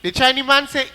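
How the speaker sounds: usual speaking voice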